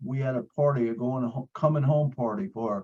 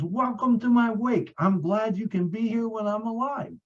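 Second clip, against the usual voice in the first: quoting Peggy; 10 semitones higher than usual. Retold or imitated. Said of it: "And she says".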